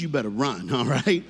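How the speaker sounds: ordinary delivery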